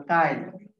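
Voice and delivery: কাইল drawn out slowly, i before l; slow dialect kail. Shown as a demonstration. Teacher slowly demonstrating কাইল for students. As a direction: stretch out কাইল slowly for the class. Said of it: "খেয়াল".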